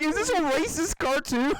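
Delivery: high-pitched, incredulous meme voice; imitated meme reactor. Retelling a meme to a friend, imitated high-pitched disbelief. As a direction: mimic an astonished video reactor. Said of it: "He's like".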